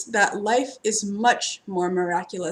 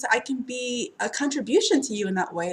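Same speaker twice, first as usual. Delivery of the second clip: ordinary talk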